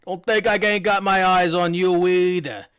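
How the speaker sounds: loud warning from a swaggering guard; suspicious guard. Acted. Guard warning Amy he is watching her, loud and suspicious. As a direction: warn her you're watching her too.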